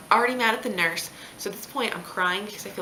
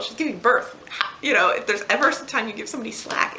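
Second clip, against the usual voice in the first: louder, higher retold protest; indignant defence; 4 semitones higher, 4 dB louder than usual. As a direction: jump to her defence, imitating an indignant mom. Mom defends daughter against husband.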